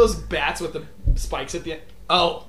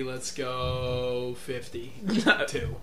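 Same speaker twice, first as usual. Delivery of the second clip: slow drawn out; 5 semitones lower, 7 dB quieter, much slower than usual. Acted. Drawn-out pick announcement.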